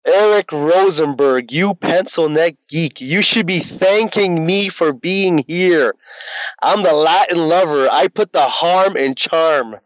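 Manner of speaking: slow, smug wrestling heel promo; purring Latin lover. Acted. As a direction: taunt him slowly like a cocky villain. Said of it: "what would you say in character?".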